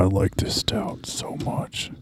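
hushed, slow whisper. Real, not acted. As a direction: whisper it slowly in secret. Whispering about the beer.

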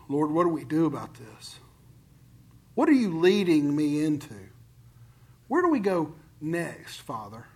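soft, low, unhurried talk to God; peaceful prayer. Acted. Preacher praying to the Father for direction, peaceful. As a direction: ask gently, dropping almost to nothing at the end. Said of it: "See, peace feels a lot like".

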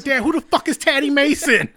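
put-on high, loud, incredulous teen daughter; exasperated teen. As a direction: fire it back at Dad in disbelief. Summer answering Jerry, quoted.

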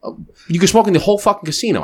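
high, fast, exasperated outrage; incredulous outburst. Retold or imitated. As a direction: fire it back in disbelief. Outraged retort about the smoking rule.

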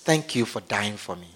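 low, soft prayer voice; leading prayer. Acted. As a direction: lead them quietly, phrase by phrase. Leads congregation in prayer.

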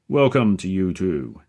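loud, hearty booming welcome. A narrator's reading. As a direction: boom it out like a showman. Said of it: "deep, booming voice".

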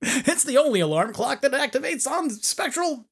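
high ad-voice tagline; infomercial. Pretend. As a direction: pitch it like a TV spot.

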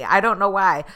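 normal talking voice

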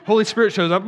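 laughing loudly as the story turns; a loud laugh bursting out of the preacher. Real, not acted. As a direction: break into a big real laugh. Laughing at his own story.

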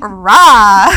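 Rah blurted low and loud. Retold or imitated. Replaying her own blurt in class, low and loud. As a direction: bark out Rah with mock confidence. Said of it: "I say".

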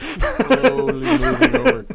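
slow, high, laughing through the words; drawn out between laughs. Real, not acted. Laughing at the movie-theatre image.

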